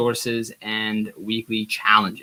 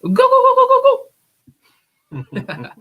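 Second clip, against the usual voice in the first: very high, loud turkey gobble; gobbling turkey voice; 6 dB louder than usual. Acted. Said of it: "I'm gonna do my best turkey impression".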